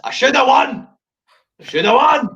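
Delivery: high-pitched, indignant mock shout; hammed-up high cry of grievance; mock outburst. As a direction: burst out in pretend outrage. Imagined outburst on a silent track.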